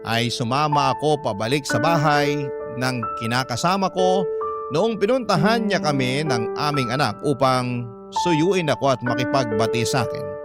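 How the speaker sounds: usual speaking voice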